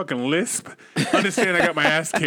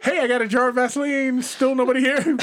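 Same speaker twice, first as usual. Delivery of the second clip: mock calling out; 7 semitones higher than usual. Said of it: "teen calling through empty house".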